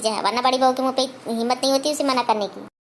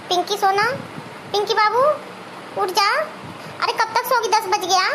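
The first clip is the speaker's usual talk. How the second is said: coaxing husband; 6 semitones higher, slower than usual. Acted. Husband coaxes sleeping wife.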